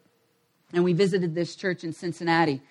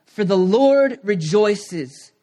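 very slow, deliberate verse reading; measured pulpit reading with long stressed words; scripture reading; 4 dB louder, much slower than usual. Acted. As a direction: proclaim the verse phrase by phrase, with weight. Reads a Bible verse aloud.

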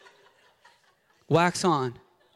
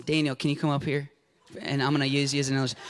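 ordinary delivery

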